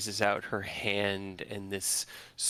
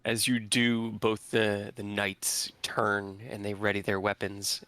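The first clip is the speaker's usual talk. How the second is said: normal talking voice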